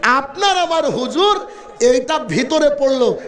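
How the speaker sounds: usual speaking voice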